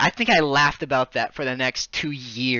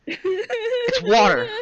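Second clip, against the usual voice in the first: high, slow, crying mockery; teasing brother after the liquid ice trick; mock wail; 3 dB louder, much slower than usual. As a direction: rub it in with a fake whine. Mocking reveal of the prank.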